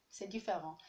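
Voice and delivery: ordinary delivery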